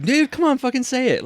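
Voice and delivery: high, pushy mock friends; egging him on. Friends heckling him to tell the joke, high mock voice. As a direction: goad him into telling it. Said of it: "everybody's like".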